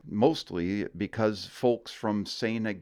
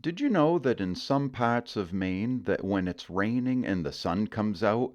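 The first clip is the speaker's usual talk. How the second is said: ordinary delivery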